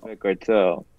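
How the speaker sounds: low, soft ominous echo; menacing gangster. Acted. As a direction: say it darkly, like a threat. Echoing the menacing line.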